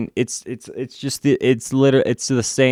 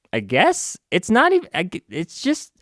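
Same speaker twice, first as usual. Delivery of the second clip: normal talking voice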